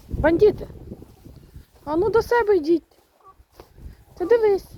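high, loud call to stray chickens. Real, not acted. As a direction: shout them back home, half scolding. Calling chickens back home. (гучно кличе курчат додому)

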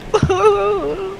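high squealing laugh. Real, not acted. Laughing mid spooky taunt.

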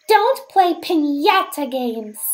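ordinary delivery

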